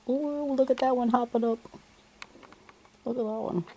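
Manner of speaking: high, delighted bird-watcher exclamation; excited. Real, not acted. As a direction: point it out with sudden delight. Spotting a bird hopping.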